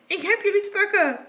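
high mocking witch cackle, then gloating; false, mean laugh. A narrator's reading. Witch catching Hans, mean triumphant laugh. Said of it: "lachte vals".

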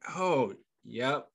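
usual speaking voice

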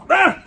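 high startled yelp. Real, not acted. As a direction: let out a short high cry. Yelps while getting down.

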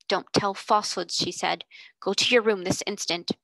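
usual speaking voice

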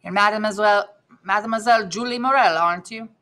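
lightly voiced Italian accent; a touch of Italian in a stranger's question. A narrator's reading. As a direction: read it with a mild Italian accent. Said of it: "he said with a pronounced Italian accent".

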